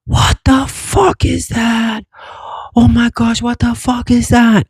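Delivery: high-pitched mock scream, drawn out at first; imitated screaming. Man retells neighbor kid screaming at UFO, high mock scream. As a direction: imitate a terrified kid screaming without real volume. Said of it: "I heard him screaming on top of his lungs".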